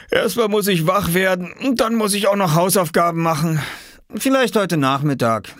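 high-pitched, sleepy excuses; drowsy friend Viktor. Acted. Viktor in bed excusing himself to Oskar, sleepy. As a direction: put a friend off while waking up.

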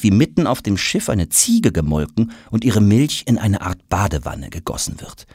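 ordinary delivery, nothing marked

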